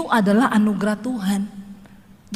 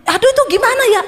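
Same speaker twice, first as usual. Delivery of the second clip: high, loud mock whine; hammed-up fretting over the phone; mock exasperated businessman; 12 semitones higher, 6 dB louder, much faster than usual. Businessman fretting on phone, loud mock whine.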